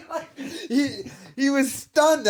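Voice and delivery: cracking up mid-sentence; laughing disbelief. Real, not acted. Laughs while mocking the host.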